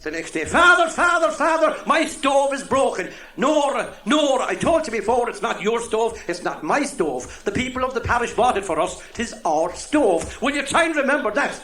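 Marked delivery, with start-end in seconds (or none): 0.4-3.1 high, loud, slow pleading call to the priest; Nora calling. Acted. Housekeeper to priest about broken stove, high pleading call. 3.4-11.6 scolding priest. Acted. Priest correcting his housekeeper, mildly exasperated.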